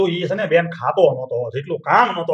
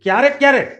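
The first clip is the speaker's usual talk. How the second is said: incredulous echo; 8 semitones higher, 3 dB louder than usual. Acted. Father scolds son over exams.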